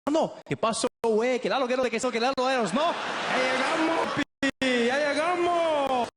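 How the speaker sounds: put-on high, loud Mexican Spanish accent; Mexican guy. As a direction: greet him like a long-lost brother from Mexico.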